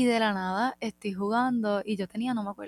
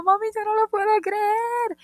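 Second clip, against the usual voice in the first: higher, louder excited squeal; starstruck squeal; 12 semitones higher, 5 dB louder than usual. Retold or imitated. Telling mom after fans asked for photos, squealing high. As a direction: squeal it to your mom in disbelief. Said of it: "Y yo".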